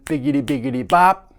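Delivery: spoken rhythm with a hard accented ending; vocalized drum pattern. Shown as a demonstration. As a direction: drum syllables landing on a loud final hit. Voicing a drum triplet pattern.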